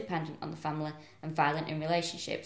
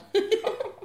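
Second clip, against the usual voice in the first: squealing high giggle; high shrieking laugh; 4 dB louder than usual. Real, not acted. Friend cracks up at the joke, high laugh.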